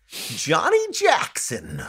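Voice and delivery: high, drawn-out American announcer drawl; exaggerated American accent. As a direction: stretch the name out in hammed-up American. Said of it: "say in an American accent".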